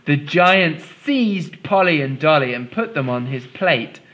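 lively read-aloud storytelling voice; story reading. A narrator's reading. Teacher reads story aloud.

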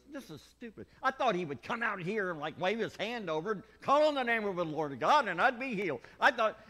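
high, loud indignant rant; Naaman ranting. Acted. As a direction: play the outraged Naaman. Naaman's angry rant voiced.

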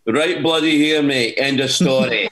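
put-on a light Scottish accent, proudly answering the crowd. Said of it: "speak with a Scottish accent".

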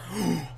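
quick startled gasp at a near miss; sharp gasp. Real, not acted. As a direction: suck in air, shocked. Sniper bullet past head.

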